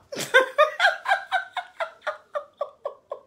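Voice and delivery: long high-pitched giggling fit; laughing at the players' nicknames, high cackling giggle; high helpless laugh. Real, not acted. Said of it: "j'en fous rire".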